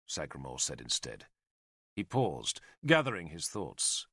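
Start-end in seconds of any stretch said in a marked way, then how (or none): none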